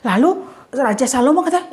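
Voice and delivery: usual speaking voice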